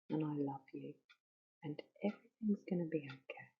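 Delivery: soft, hushed comfort to a hurting part; tender reassurance. Shown as a demonstration. Whispering comfort to the cushion held in bed. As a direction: soothe it gently like a child in your arms. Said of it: "just telling it exactly what we said".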